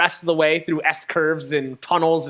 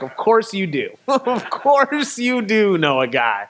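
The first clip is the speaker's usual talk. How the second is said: higher, slowed, laughing through the words; laughing disbelief; 5 semitones higher, slower than usual. Retold or imitated. To the mechanic who knows a guy, laughing disbelief. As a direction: crack up at the cliché as you say it.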